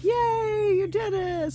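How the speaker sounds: high, loud cheer; cheering yay. Retold or imitated. Young guy returns to praise her, high loud cheer. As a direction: cheer like he's proud of her.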